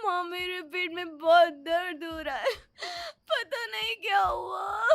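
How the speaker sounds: high, crying voice, hurting and scared; tearful pain. Acted. Daughter tells her mother about the pain, high and crying. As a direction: plead through the pain, near tears.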